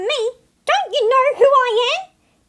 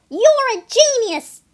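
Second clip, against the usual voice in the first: ordinary delivery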